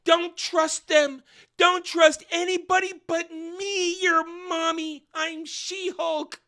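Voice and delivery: high, loud, slow wailing mock cry; hammed-up desperate yell. Mother dragged away yelling at her little boy, high wailing cry. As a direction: wail it as you're dragged off. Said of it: "she yells at the little boy".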